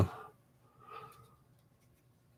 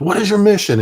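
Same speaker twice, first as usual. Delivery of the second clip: higher and louder, impatient demand; angry sergeant; 11 semitones higher, 6 dB louder than usual. Retold or imitated. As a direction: repeat the question as he gets pissed. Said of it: "he started getting pissed".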